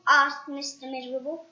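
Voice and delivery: plain reading voice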